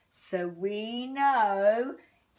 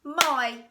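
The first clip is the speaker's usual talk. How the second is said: My said loud and higher, one beat; clapped syllable; 5 semitones higher, 7 dB louder than usual. Shown as a demonstration. Teacher claps My for children, loud single beat. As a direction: clap out My once. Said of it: "the next one is my".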